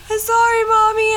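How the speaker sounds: put-on high, tearful little-boy voice; imitated crying child. Son apologizing to his mom, imitated tearful squeak. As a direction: mimic a small child's weepy apology. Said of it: "started crying, too".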